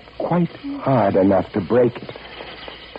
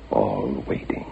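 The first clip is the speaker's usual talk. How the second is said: whispered dread; hushed whisper; 6 semitones lower, 4 dB quieter than usual. A narrator's reading. Keeper in darkness surrounded by rats, hushed whisper. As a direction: whisper it in the dark. Narrator in dark among rats.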